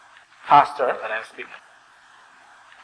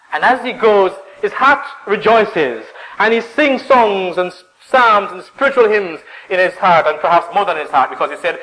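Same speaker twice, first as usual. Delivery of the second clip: raised, louder preaching voice; joyful preaching; 5 semitones higher, 4 dB louder than usual. Real, not acted. Preacher describes a worshipper going up singing, joyful. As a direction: build with the rejoicing.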